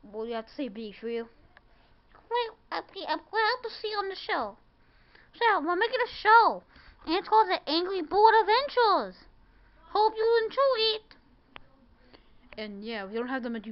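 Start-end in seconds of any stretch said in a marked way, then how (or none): 2.3-11.1 higher, louder toy-bird voice; chirpy bird character hosting the show. Pretend.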